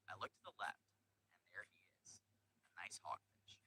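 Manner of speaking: slow hushed whisper. A narrator's reading. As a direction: whisper it like spotting prey. Sudden sighting of hidden fish.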